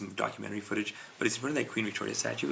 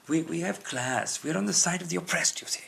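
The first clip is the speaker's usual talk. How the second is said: put-on slow, higher British accent; 6 semitones higher, slower than usual. British accent to his podcast partner, half-joking. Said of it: "I said that with British accent".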